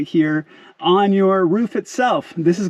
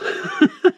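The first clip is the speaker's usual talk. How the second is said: short, high-pitched giggle; a real burst of high laughter; amused laugh. Real, not acted. Host amused at how simple the install is, amused laugh.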